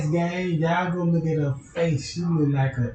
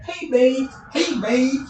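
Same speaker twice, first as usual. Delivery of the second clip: higher sing-song call; creepy dream voice; 7 semitones higher, slower than usual. Retold or imitated. The voodoo woman calls out in his sleep. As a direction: tease him from inside the dream.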